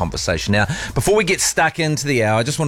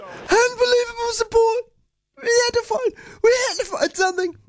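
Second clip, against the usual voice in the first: much higher, strained voiceless rasp; Harry Kane impression. Host copying the captain's post-match speech, high strained rasp. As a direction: squeeze out the words like a wrecked voice. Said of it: "trying to imitate Harry Kane".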